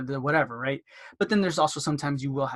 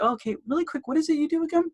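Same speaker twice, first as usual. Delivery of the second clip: friendly caller; 13 semitones higher, faster than usual. Acted. Acted chat with a patient.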